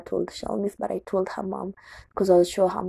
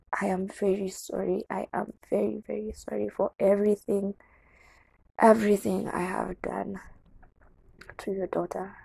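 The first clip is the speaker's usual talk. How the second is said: much softer, fading retold apology; a quiet, mild tearful sorry; 7 dB quieter than usual. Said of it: "I was crying the whole time".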